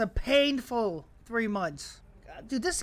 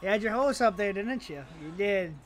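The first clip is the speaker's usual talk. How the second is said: ordinary talk, nothing marked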